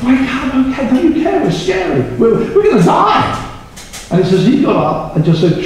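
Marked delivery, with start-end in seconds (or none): 0.0-3.4 high, urgent mock panic, rising to a shout; panicked disciples. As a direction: act out frightened men waking a sleeper, ending high. Voices panicking disciples in storm.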